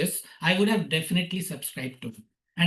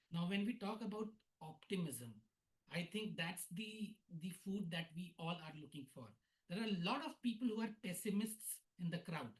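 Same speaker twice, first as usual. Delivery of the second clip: barely audible; 21 dB quieter than usual. Real, not acted. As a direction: voice very faint and distant. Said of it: "but your voice is very low".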